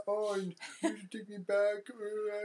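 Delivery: high, drawn-out whiny blubbering; mock crying. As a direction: imitate a big baby crying on the phone. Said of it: "he's crying on the phone".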